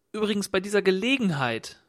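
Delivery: quick. A narrator's reading. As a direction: jump in briskly with a new idea. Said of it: "bemerkte rasch der Fremde". (rasch und höher eingeworfen)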